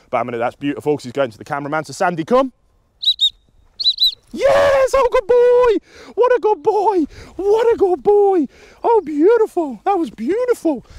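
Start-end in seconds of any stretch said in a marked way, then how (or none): 1.9-2.5 higher recall call to a dog. Real, not acted. Calling the dog to come. 4.3-10.9 very high, loud squealing praise; excited dog praise. Real, not acted. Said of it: "vocal inflection, praise, physical praise".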